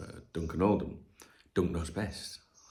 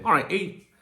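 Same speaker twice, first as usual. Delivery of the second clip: mimicking ex-wife; 10 semitones higher, 4 dB louder than usual. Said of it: "she would say".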